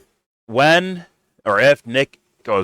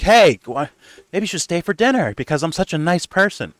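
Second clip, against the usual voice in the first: higher, sugary fake-nice invite; mock sweet; 7 semitones higher than usual. Mocking the woman's fake dinner invite, higher and sugary. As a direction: play the falsely kind hostess.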